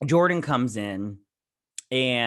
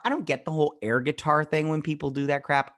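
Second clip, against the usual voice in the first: ordinary delivery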